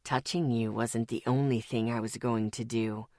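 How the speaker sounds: low, soft cruel taunt in the father's voice. Acted. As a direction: tease him with the threat, savouring it. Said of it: "it taunted".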